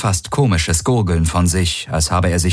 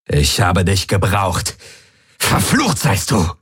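bitter curse. Acted. Valur cursing the corpse.

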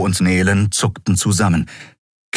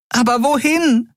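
very high, shrill question; a tiny voice near panic; panicked fairy. A narrator's reading. Nelen asks Keru where to go, high and panicked.

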